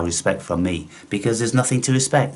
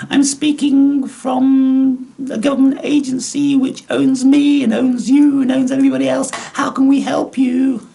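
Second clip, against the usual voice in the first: hammed-up shrill telephone voice; mock office drone. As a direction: parody a chirpy government call-handler. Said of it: "you'll be thinking".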